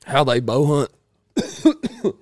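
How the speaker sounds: words squeezed out while choking; choking on snuff. Real, not acted. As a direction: voice catching, choking mid-word. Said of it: "Sorry, I got choked on my snuff".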